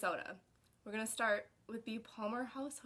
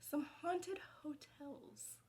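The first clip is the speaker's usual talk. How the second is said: slow, breathy haunted whisper; spooky whisper; 7 semitones higher, 7 dB quieter, slower than usual. Shown as a demonstration. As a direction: whisper it like a ghost story. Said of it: "I realized that was a little quiet".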